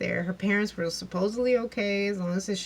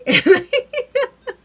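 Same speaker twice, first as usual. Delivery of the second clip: loud laugh; 12 dB louder than usual. Real, not acted. Laughs at own retelling.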